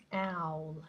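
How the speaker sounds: owl said with an open mouth like an A. Shown as a demonstration. Teacher repeating owl for students, mouth wide open.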